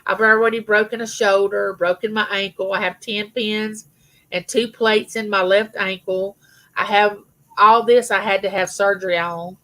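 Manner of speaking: usual speaking voice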